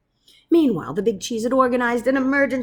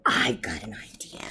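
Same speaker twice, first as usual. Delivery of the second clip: hushed squeak; 10 semitones lower, 5 dB quieter than usual. Acted. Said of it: "squeaked the Big Cheese".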